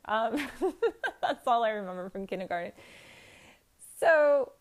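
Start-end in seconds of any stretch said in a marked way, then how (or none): 0.5-1.2 quick, high leftover laugh; short high giggle. Real, not acted. Still amused by the kindergarten story, brief high laugh. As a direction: let one more high giggle slip out.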